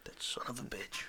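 very soft, whispered curse; acted hushed bitterness; muttered curse. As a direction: curse under your breath. Detective learns of friend's corruption.

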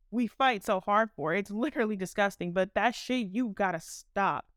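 ordinary talk, nothing marked

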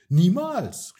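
loud, high cry of defiance; shouting. Acted. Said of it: "rief Lina".

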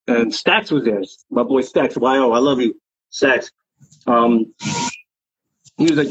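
ordinary delivery

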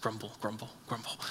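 sulky muttered grumbling; mock grumbling. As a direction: mutter it under the breath like a sulking churchgoer. Mocking grumble after complaint.

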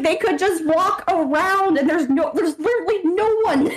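high-pitched imitation of a fed-up boyfriend; mock exasperated whine; exasperated complaint. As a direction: rant it high, in disbelief.

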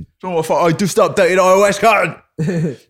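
comic outburst of outrage; mock angry rant. Acting out user's angry rant.

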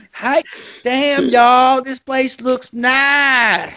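high, very slow, shouted Southern twang; bad Southern accent. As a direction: ham up a drunk Southern girl. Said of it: "I can't do the Southern".

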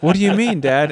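put-on high, loud little-girl question; imitating daughter. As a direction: pitch it way up as a puzzled child. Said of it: "And she's like".

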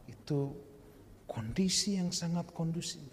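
hushed, secret aside; whisper. Acted. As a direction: lean in and whisper it. Whispered sermon point. (berbisik pelan)

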